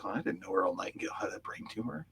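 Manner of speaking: hushed, voiceless murmur of surprise; whispered aside. Retold or imitated. Son behind his father's shoulder, whispered aside.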